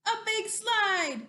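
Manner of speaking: loud, high storybook announcement; big shouted reveal for kids; dramatic challenger reveal. Acted. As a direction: announce it like a mighty opponent. Announces dinosaur's next opponent.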